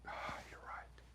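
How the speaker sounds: breathy whisper, almost no voice; whispered admission. Retold or imitated. Client whispering his admission to the coach. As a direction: whisper it like a quiet confession. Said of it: "And he just went".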